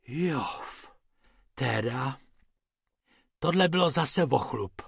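soft, breathed-out phew; hushed sigh of relief; exhaled relief. A narrator's reading. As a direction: let it out quietly after a close shave. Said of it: "vydechl Bobek".

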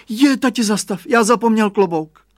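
put-on very high, loud child's shout; cry out. A narrator's reading. Boy crying out to father about his forgotten hat, very high and loud. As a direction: yell in sudden alarm. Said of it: "vykřikl".

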